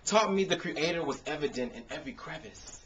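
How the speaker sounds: rhythmic performed poetry with punchy builds; spoken word poem. Acted. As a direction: perform it like a slam poem on an open mic stage.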